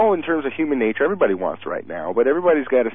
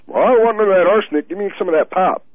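mocking dim voice; 8 semitones higher, 6 dB louder, faster than usual. Mocking a gullible voter.

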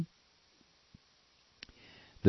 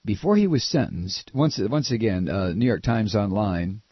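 ordinary talk, nothing marked

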